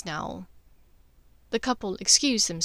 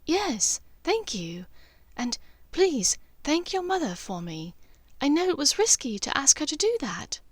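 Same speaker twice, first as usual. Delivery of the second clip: lightly higher, grateful voice; Hermione reply; 6 semitones higher than usual. Acted. Hermione answers Draco's message, lightly higher and grateful.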